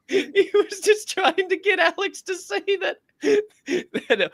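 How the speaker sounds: very high, squeaky laughing talk; helpless laughter. Real, not acted. Fan retelling Alex Jones clip, cracking up. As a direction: squeeze the words out through real laughter.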